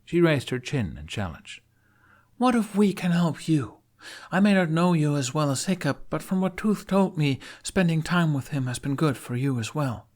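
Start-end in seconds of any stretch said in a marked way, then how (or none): 2.4-10.1 put-on higher, challenging young woman; Astrid. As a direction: chin up, offer help.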